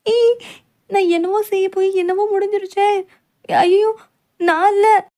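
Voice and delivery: high, loud frantic squeal; panicked wail. Acted. Caught after spilling everything, panicked wail.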